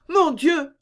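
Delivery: high-pitched frightened exclamation; alarmed cry. A narrator's reading. Louise listening at the door, alarmed cry. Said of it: "s'écria Louise".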